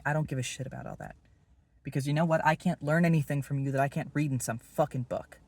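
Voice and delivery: heated outburst. Acted. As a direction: slam the point down. Heated rebuke to Will.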